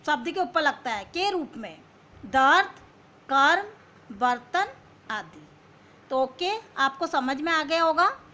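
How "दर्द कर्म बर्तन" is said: दर्द and कर्म called out much louder, the रेफ crisp; loud example words. Shown as a demonstration. Teacher drilling children, loud रेफ words. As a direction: hit each रेफ word loudly. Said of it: "रेफ जो ऊपर किसी".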